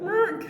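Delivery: high squeaky bird squawk; parrot squawk. Retold or imitated. The bird reacting inside the house: a high squawk.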